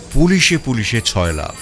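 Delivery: ordinary delivery, nothing marked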